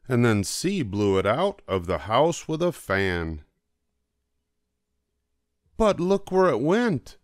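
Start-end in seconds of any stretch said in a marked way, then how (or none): 5.8-7.2 put-on a child's voice pitched higher, calling out to the cats; excited child calling out. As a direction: call out higher, like an excited kid pointing. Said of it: "I said".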